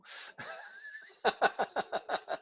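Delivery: high, breath-pulsed chuckle; a long run of quick, high laughing. Real, not acted. Teacher hearing a correction from the back, laughing.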